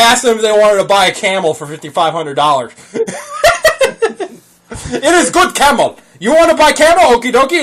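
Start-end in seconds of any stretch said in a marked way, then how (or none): 4.9-7.6 put-on higher, louder foreign-accented salesman; Okie Dokie Man voice. Trolling a scam caller as a camel seller, higher and louder. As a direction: hawk the camel in a broken accent. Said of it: "pretended I was Okie Dokie Man".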